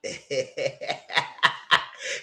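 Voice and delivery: breathy laugh. Real, not acted.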